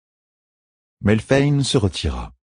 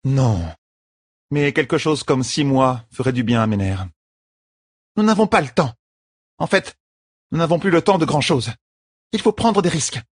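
higher, urgent warning; serious champion; 8 semitones higher than usual. A narrator's reading. Champion warns the queen, growing urgent. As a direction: turn grave mid-speech.

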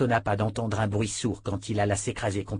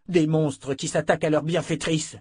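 angry shout; 6 semitones higher than usual. Acted. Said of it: "cria Richard".

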